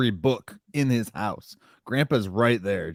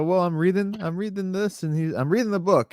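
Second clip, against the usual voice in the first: higher, lightly voiced earnest Herman; lightly voiced Herman, a bit higher; Herman explaining; 8 semitones higher than usual. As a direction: say it brightly, like an eager student. Voicing Herman explaining to Grandpa.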